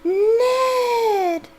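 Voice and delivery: high, loud, drawn-out squeal; gushing, high-pitched cry; excited squeal. Pretend. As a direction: squeal the name like a smitten girlfriend. Gushes at hearing Ned's voice.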